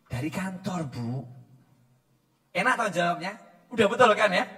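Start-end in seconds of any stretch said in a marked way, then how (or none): 0.1-1.3 husband answering softly; quiet and humble, keep it low; a meek husband's hushed "Dari kantor, Bu". Retold or imitated.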